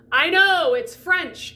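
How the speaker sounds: loud, high mock exasperation; exasperated sympathy. Teacher answers confused student, loudly exasperated. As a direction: cry out in shared frustration.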